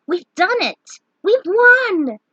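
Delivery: high, loud triumphant shout; joyful cheer. Acted. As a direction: burst out in disbelieving victory. Lunith realizes they survived.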